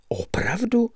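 high, hushed disbelief; astonished. A narrator's reading. Narrator marvels at the game's rules, astonished. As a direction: let it leap up softly in wonder. Astonished listener reacts to explanation.

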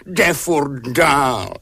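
slow, drawn-out whine, falling; uhňaně. A narrator's reading. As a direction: whine it out in a put-on voice. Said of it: "uhňala". (táhlé kňourání)

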